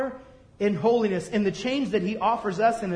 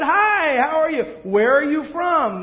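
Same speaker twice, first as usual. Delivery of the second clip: high, loud and booming; glad-hand the strangers; hearty greeting; 7 semitones higher, 6 dB louder, slower than usual. Retold or imitated. Friendly old man greeting diners loudly.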